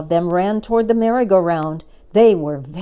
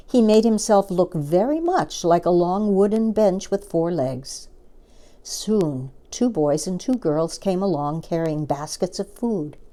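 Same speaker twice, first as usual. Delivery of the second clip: ordinary delivery, nothing marked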